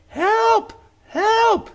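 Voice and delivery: very high, loud frantic cries; panicked cry for help. Acted. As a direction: yell for help while running away in terror. Fleeing cultist calls for help.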